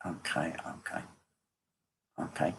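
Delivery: barely audible, spaced-out acknowledgements; murmured aside. Real, not acted. Medium pausing mid-reading to listen, murmured aside. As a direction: murmur to yourself as if listening privately.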